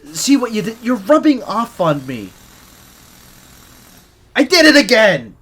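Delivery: high, loud, flustered yelp; flustered outburst. Acted. Flustered scientist yelping at the teasing agent. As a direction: burst out, caught off guard and embarrassed.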